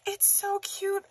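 very high, soft squeak; cooing delight. Real, not acted. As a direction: coo it tiny and high. Cooing over gift item.

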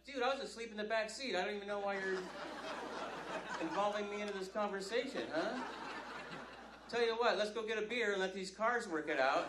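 lightly voiced sleepy dude, easygoing; a hint of a mellow stoner; laid-back guy. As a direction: shrug it off and offer a beer. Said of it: "the other guy will be like".

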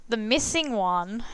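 ordinary talk

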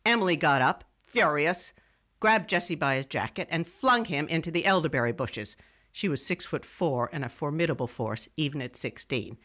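ordinary delivery

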